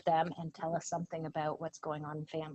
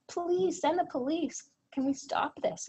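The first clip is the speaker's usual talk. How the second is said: high, drawn-out imitated pleading; raised pitch, retold distress; retold desperate plea; 8 semitones higher, 4 dB louder than usual. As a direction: imitate a child begging for help. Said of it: "And she starts screaming".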